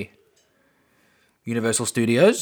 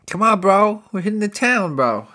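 higher, louder party-bro voice; 9 semitones higher, 5 dB louder than usual. Retold or imitated. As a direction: hype him up for a night out. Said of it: "And he goes".

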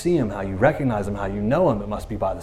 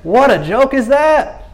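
ordinary delivery

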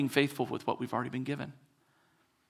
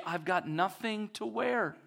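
mock fashion complaint; 6 semitones higher, 4 dB quieter, slower than usual. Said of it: "They can open that and say".

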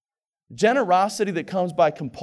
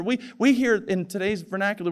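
ordinary talk

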